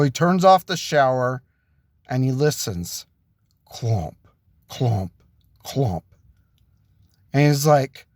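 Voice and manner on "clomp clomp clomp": low, heavy "clomp", each one separate; imitated footsteps. Storyteller imitating someone coming through the house, low clomps. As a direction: drop low for each footfall sound.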